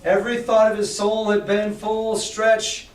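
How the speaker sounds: ordinary delivery, nothing marked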